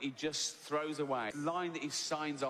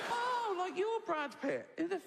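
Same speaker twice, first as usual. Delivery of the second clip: camp mock flirt; 12 semitones higher than usual. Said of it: "And I go".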